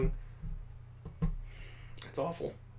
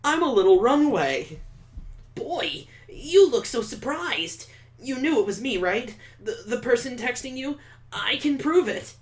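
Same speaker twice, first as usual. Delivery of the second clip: put-on higher, gushing eager boy voice; eager Victor; 8 semitones higher than usual. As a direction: play a giddy date who can't wait. Voicing Victor in the game.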